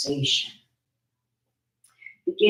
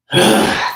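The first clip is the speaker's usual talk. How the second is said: short, forceful audible sigh; big exhale; 5 semitones higher, 10 dB louder than usual. Shown as a demonstration. Meditation guide showing a big exhale, strong mouth sigh. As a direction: push a big breath out through the mouth. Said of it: "through the mouth".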